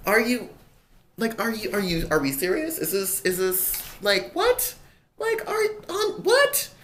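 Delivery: can't believe this is happening, voice shooting up; real incredulous, rising to a squeak; climbing disbelief. Real, not acted. Disbelief at a stranger in bathroom.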